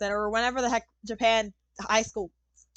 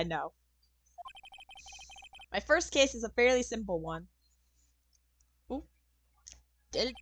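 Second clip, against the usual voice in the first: ordinary delivery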